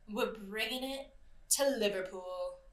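playful touch of a British accent; a hint of a British accent. Shown as a demonstration. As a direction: toss in a quick, half-hearted British accent. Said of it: "I had to add an accent in there".

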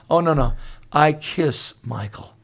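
ordinary delivery

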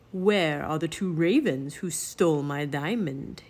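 low, raspy villain voice; raspy Raven King. A narrator's reading. Raven King questions the children, raspy voice. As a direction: rasp it out as a menacing bird king. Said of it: "he asked in a raspy voice".

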